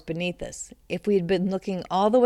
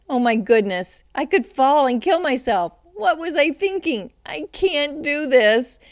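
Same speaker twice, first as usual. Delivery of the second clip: higher, louder, alarmed inner voice; panicked thoughts; 7 semitones higher, 5 dB louder than usual. Acted. Racing thoughts on the ladder.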